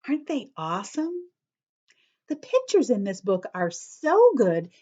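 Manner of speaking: ordinary delivery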